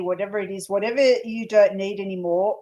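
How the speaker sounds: ordinary talk, nothing marked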